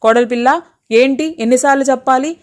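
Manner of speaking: ordinary talk, nothing marked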